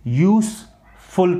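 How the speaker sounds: "यूज़फुल" said "yoos-ful" with an s; yoosful with s. Shown as a demonstration. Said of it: "क्या pronounce करोगे". ("यूज़फुल" को s के साथ)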